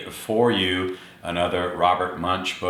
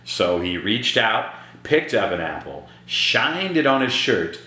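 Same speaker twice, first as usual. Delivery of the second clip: ordinary delivery, nothing marked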